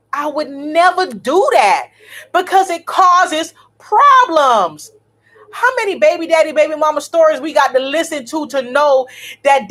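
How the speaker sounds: high, loud, indignant tirade; heated rant. Real, not acted. Telling viewers about her ex bringing a date, loud and outraged. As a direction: let the outrage pour out. Ranting about ex's new girlfriend.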